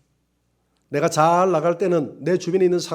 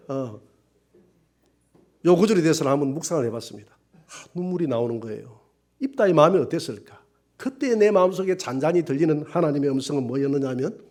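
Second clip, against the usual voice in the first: ordinary talk, nothing marked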